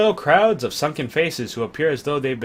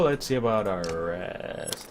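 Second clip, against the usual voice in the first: normal talking voice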